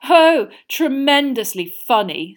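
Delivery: very slow, high mocking sneer. A narrator's reading. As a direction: drip sarcasm slowly. Malfoy sneering on.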